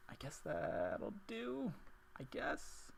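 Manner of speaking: breathy whisper to herself; under her breath, unvoiced. Real, not acted. Muttering to herself over the sketch.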